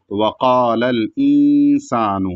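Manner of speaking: slow recitation with a short nasal ن in الْإِنسَانُ; Quran recitation. Shown as a demonstration. Teacher modelling the verse for students, slow chant. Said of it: "اس طریقے سے پڑھیں گے".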